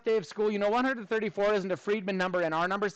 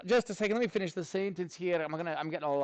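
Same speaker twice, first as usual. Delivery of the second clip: ordinary talk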